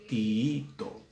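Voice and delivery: "Tiito" as ti-Í-to, syllables separated; double-i hiatus. Shown as a demonstration. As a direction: stretch the second i of "Tiito". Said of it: "Una se va a una sílaba".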